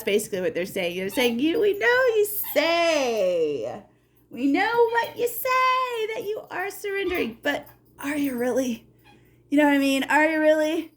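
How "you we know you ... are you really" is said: teasing spirits. Acted. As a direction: needle them about their surrender. Voicing spirits doubting surrender.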